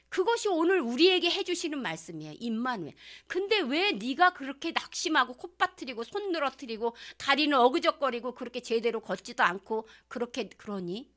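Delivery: ordinary talk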